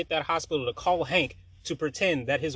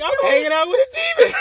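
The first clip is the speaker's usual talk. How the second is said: high, loud, half-laughing, half-crying wail; laughing shriek; 12 dB louder than usual. Retold or imitated. Telling his friend she is a demon: high, loud, laughing wail.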